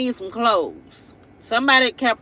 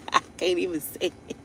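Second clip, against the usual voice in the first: laughing quietly; 5 semitones lower, 8 dB quieter, faster than usual. Real, not acted. Laughing it off the second time.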